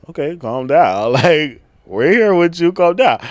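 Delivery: loud, high, laughing "calm down" to herself; giggly loud self-correction; laughing self-hush. Real, not acted. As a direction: rein yourself in while cracking up. Hyping herself up mid-cuss.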